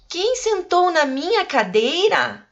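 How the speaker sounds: "cadeira" rising as a clear question, pitched high. Shown as a demonstration. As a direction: read "Quem sentou na minha cadeira?" as a real question. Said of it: "Olha a voz de pergunta aqui". (voz de pergunta)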